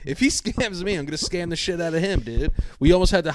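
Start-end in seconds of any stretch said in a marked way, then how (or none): none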